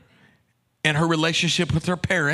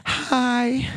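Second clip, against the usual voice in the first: shy hi; 7 semitones higher than usual. Retold or imitated. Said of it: "and she says".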